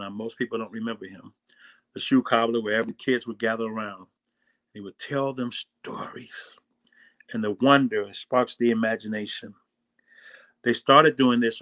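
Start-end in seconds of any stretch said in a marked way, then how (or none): 4.7-6.5 slow hushed whisper. Real, not acted. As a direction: hush it like a secret. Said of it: "hushed storytelling aside".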